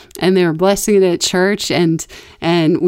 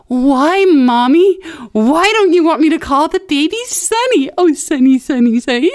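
high, louder fake-innocent pleading; mock-innocent whine; 8 semitones higher, 4 dB louder than usual. Cousin baiting her mom, high fake-innocent whine.

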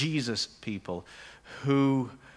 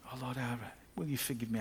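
softer, pleading mock prayer; mock heartfelt plea; 9 dB quieter, faster than usual. Sinner begging God again, softer mock pleading. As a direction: hush it like an overdone apology. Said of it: "just say it like you really mean it".

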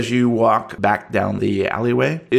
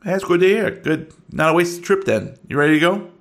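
Bugsy; 6 semitones higher than usual. Acted. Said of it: "Bugsy is idling in the U-Haul".

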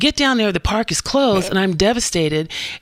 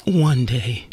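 much lower, put-on old film character; Sounder voice; 9 semitones lower than usual. Redoing the one day speech on request, low Sounder voice. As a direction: drop low like the man in Sounder. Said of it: "in the Sounder voice".

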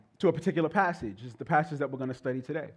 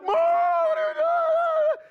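much higher, loud whiny shout; kid protesting; 3 dB louder, much slower than usual. Retold or imitated. Boy yelling at his mother for turning off cartoons, indignant shout. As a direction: yell at Mom like an indignant kid.

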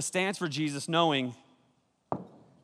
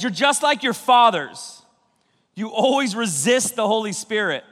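fiery rebuke; 5 semitones higher, 5 dB louder than usual. Acted. Preaching Stephen's rebuke.

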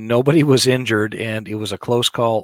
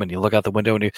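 ordinary talk

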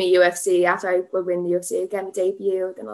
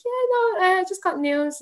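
brittle brave reply; 11 semitones higher than usual. Retold or imitated. Upset answer to supervisor, retold.

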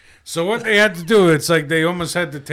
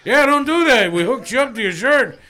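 higher-pitched quote of the staff; a touch of mock scolding, pitched up; lightly voiced medical staff; 7 semitones higher, 4 dB louder than usual. Staff telling the patient off, lightly imitated higher voice.

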